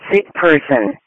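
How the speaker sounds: normal talking voice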